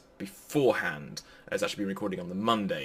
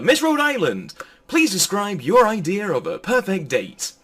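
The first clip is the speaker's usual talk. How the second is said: higher and louder, a hammed-up game-show host; William Shatner impression; 10 semitones higher, 7 dB louder than usual. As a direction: ham up a pageant host asking the question. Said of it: "the first person you hear speak is William Shatner".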